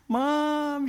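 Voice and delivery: high, sustained call; crying out for Mom. Retold or imitated. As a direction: stretch it out like a lost boy calling. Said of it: "I cried out".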